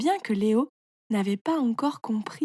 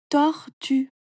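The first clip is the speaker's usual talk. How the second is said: "tortue" as tor, pause, tue; "tortue" in two higher, separate beats; syllable by syllable; 4 semitones higher, 3 dB louder than usual. Shown as a demonstration. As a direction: break "tortue" cleanly in two. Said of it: "tu coupes le mot en petites parties".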